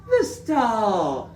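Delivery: high, loud astonished gasp; gasped surprise. Acted. Little Bear opens the parcel and finds the star, gasping. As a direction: gasp it out in wonder. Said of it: "gasped Little Bear".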